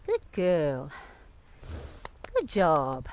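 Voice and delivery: low, gentle praise for the horse. Real, not acted. Praising the horse after a step.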